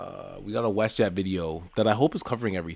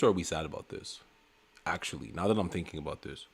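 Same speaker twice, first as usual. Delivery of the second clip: ordinary talk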